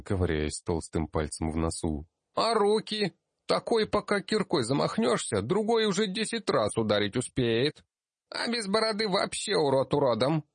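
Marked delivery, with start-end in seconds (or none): 2.3-10.4 put-on high blunt kid voice; a kid passing a rude verdict; cheeky child gnome. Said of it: "припечатал". (дерзкий детский голос)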